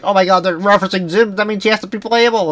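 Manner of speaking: put-on high excited kid voice; hyped-up fan voice, pitched high; mock excited kid.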